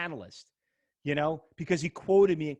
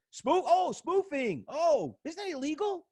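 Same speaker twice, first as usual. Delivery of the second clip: "spoofing" jumps much higher in feigned surprise; mock realization; 10 semitones higher, slower than usual. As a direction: play dumb, pretend to just get it. Mock realization mocking the analyst.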